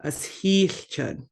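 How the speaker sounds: "heelchud" with the middle of heel held a little long; drawn-out sound. Shown as a demonstration. Teacher showing learners the double letter, drawn out a little. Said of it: "gonna draw it out just a little bit".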